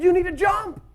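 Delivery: high wife voice urging him; wife's voice. Retold or imitated. Wife telling husband to jump, high voice. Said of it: "She said".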